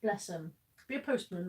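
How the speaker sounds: ordinary talk, nothing marked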